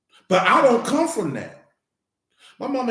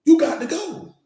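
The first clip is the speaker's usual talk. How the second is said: higher, louder, punching it out; sharp you got to go; emphatic; 9 semitones higher, 3 dB louder than usual. Acted. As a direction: make it final, up and loud. Repeats the line for emphasis.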